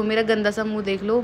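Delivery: usual speaking voice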